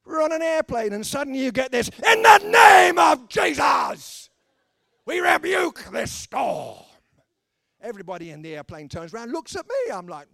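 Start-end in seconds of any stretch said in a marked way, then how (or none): 1.9-6.8 hammed-up full-force prayer shout, high and drawn out; slow, very loud, high mock preaching; imitated evangelist shouting. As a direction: bellow like an evangelist rebuking a storm. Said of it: "why can't evangelists pray quietly?".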